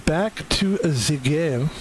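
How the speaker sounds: slow, drawn-out mock French accent; lightly put-on French accent; bad French accent. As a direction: stretch it out like a hammed-up Frenchman. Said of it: "I do speak with a bad French accent".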